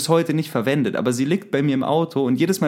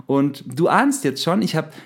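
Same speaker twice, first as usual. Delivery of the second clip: ordinary talk, nothing marked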